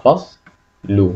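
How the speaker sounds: "فصل لو" said as two parts, "فص" then "لو", pause between; split into syllables. Shown as a demonstration. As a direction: break "فصل لو" after the closed first syllable. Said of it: "لما نيجي نحلل".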